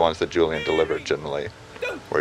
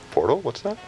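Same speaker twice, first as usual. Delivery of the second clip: surprised; 9 semitones higher than usual. Retold or imitated. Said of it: "I said".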